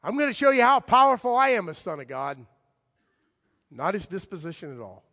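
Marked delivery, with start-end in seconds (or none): 0.0-2.4 higher, louder swaggering challenge; hammed-up boast; mock boastful bravado. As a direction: play the show-off strongman. Mock boastful Jesus misreading.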